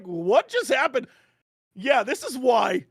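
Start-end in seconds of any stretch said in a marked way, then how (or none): 0.0-1.1 stunned. Retold or imitated. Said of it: "It was like".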